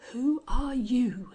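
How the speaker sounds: slow, breathy low question; low quivering voice. A narrator's reading. The Angel facing Sir John, low and quivering. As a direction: let the voice shake. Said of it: "in a low, quivering voice".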